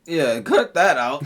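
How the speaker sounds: laughing. Real, not acted. Embarrassed after crude joke.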